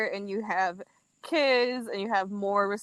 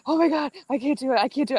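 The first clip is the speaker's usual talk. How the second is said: retelling her own panic, pitch raised and fast; mock panic; 6 semitones higher, 4 dB louder, faster than usual. To her sister, panicky refusal to go on the trip, hammed up. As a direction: replay the freak-out, rushed and higher. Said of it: "I was really freaking out".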